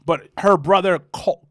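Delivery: normal talking voice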